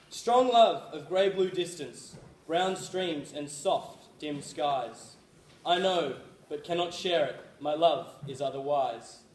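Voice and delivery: slow, measured poem reading; poem recitation. Acted. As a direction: read it like a heartfelt poem.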